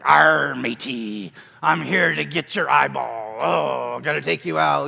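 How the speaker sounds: loud, drawn-out pirate demo. Shown as a demonstration. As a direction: growl it out like a hammy pirate. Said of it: "you're a pirate".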